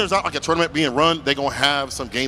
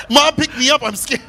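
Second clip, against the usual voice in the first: high, laughing mock-scared kid plea; 10 semitones higher, 3 dB louder than usual. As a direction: play frightened for a laugh, pitched way up. Voicing the scared crowd, joking.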